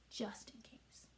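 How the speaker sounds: soft breathy aside; whisper. A narrator's reading. Narrator whispers about pirates, secret.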